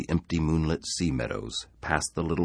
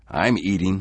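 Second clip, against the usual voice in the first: raised, angry retort; 6 semitones higher, 7 dB louder than usual. A narrator's reading. Harry to the needling men, angrily defensive. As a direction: answer back angrily, louder. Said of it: "he said angrily".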